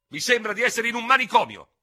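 high, loud exasperated outcry. Acted. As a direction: at the end of his patience. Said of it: "fece esasperato". (esasperato, acuto e forte)

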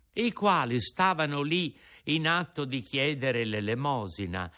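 plain reading voice

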